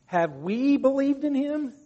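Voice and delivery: high, loud, slow mock indignation; acting out affronted authority; self-important religious leaders. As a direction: stretch it out as a haughty rhetorical question. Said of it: "They set themselves as the standards".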